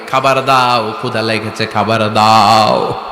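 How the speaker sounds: low, drawn-out mock plea; imitated cry for food, voice kept low; retold hungry cry. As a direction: stretch the last word like a chanted begging call. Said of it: "চিৎকার দিয়ে বলবে".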